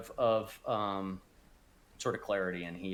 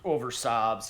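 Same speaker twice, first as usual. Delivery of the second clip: usual speaking voice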